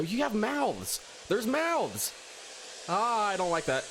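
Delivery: high, frightened; startled fear rising; scared. Real, not acted. Monster with mouths appears.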